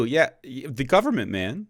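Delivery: ordinary talk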